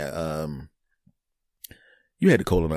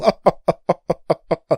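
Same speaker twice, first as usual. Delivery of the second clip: loud, high devilish laugh; evil clown cackle; 8 semitones higher, 6 dB louder than usual. Acted. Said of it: "an evil clown or a devil".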